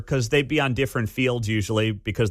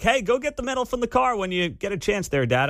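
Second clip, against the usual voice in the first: put-on kid asking; 9 semitones higher than usual. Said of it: "She's like".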